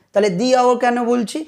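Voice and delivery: ordinary talk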